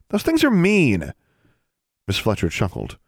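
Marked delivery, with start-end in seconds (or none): 0.1-1.1 high, drawn-out protest; slow, aggrieved outburst on a high pitch; indignant complaint. A narrator's reading. Student to the custodian about goats, indignant.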